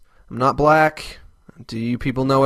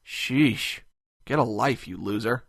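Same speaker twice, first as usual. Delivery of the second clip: softer, trailing-off put-down; dismissive mutter; 3 semitones lower, 7 dB quieter, slower than usual. Acted. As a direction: mutter the insult under your breath.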